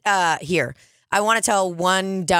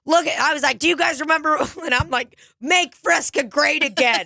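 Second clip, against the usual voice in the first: mock rally cry; 11 semitones higher than usual. Showing the bar the old logo, hammed-up rally cry. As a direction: pitch it like a campaign slogan.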